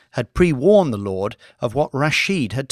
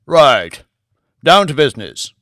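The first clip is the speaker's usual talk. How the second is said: brisk and louder, cutting to the point; 3 semitones higher, 8 dB louder than usual. Acted. Lord turning to business with his bankers, brisk. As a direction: snap the meeting into business. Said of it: "The Lord sounded brisk".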